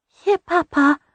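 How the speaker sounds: put-on high toddler voice; little girl voice. A narrator's reading. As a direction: offer it sweetly like a tiny child. Toddler offers biscuit to father.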